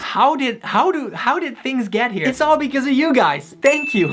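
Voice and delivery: real excited gratitude; excited thanks. Real, not acted. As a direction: gush with thanks. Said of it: "I genuinely wanna thank you guys".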